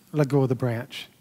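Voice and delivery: low, softly voiced divine command; voice of God. God answering the hanging man, low and calm. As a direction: repeat it low and soft, unmoved by the plea. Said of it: "And God said".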